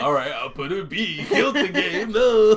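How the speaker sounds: high, loud laughing imitation; hammed-up panicked reply; mock Thug reply. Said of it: "He's like".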